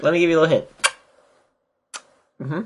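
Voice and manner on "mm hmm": quick smacking kiss sound; a short kiss smack at the lips. Shown as a demonstration. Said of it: "It's a kiss sound I made".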